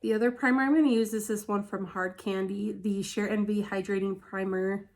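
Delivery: ordinary talk, nothing marked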